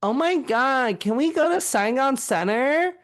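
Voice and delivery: put-on high, loud Valley Girl voice; Valley Girl accent. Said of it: "nastiest Valley Girl accent".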